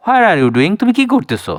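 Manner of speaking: normal talking voice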